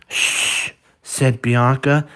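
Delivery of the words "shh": soft unvoiced shushing; hushing shh. Bianca hushing Bernard so nobody wakes, soft shh. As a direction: hush him quickly.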